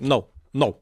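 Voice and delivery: lightly voiced Bernie Sanders; a hint of Bernie's firm no; Bernie Sanders impression. Host imitating Bernie turning down his question. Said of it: "that's how he said".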